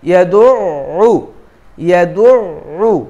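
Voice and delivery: ইয়াদুউ with the doubled দ held a beat and a half; held shadda. Shown as a demonstration. Teacher drills the shadda on ইয়াদুউ. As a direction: hold the দ in ইয়াদুউ longer. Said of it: "একটু ধরে".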